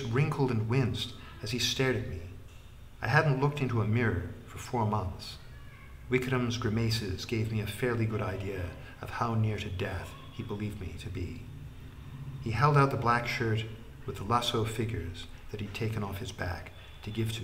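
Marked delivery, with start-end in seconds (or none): none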